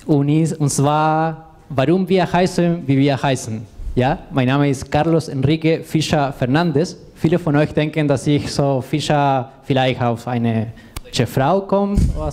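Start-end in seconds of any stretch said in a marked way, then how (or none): none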